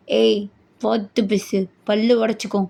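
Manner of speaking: low, deliberate warning; threatening husband. Acted. Husband warning wife to watch her words, threatening.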